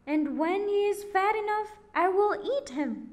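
high, loud, harsh witch voice; witch crying out. A narrator's reading. Witch waking Gretel with orders, high and loud. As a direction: bark orders at her like a cruel witch.

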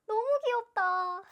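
high, loud, drawn-out gushing; overwhelmed fan squeal; cuteness squeal. Real, not acted. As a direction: wail with delight at the cuteness. Gushing over cute photo.

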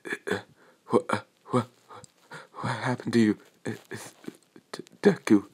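breathless, faltering stammer, mostly soft; panting and stammering. A narrator's reading. Shaken bully stammering at Deku, breathless and soft. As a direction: gasp out the words, shaken. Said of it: "Him panting and just".

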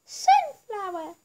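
high, chirpy plant character voice; sunflower voice. Retold or imitated. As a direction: squeak it out like a cheery little flower. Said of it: "that was my sunflower impression".

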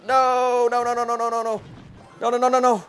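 high, rapid string of no no no; real panic as the tower falls; panicked no's. Real, not acted. Losing the match, panicking.

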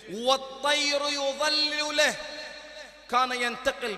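slow, loud, rising preaching; full-force preaching. Real, not acted. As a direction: paint the procession with awe. وصف موكب سليمان بحماس. (وعظ بصوت عالٍ ومتمهل)

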